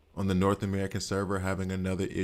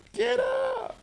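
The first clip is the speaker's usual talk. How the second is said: 1 mock pleading; much slower than usual. Urging downed character in game.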